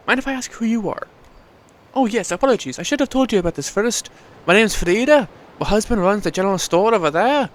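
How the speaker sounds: put-on Welsh accent. Frida introducing herself to the stranger, light Welsh lilt. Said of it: "Welsh accent".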